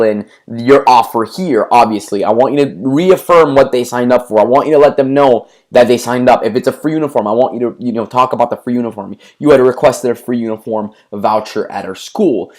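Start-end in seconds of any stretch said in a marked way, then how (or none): none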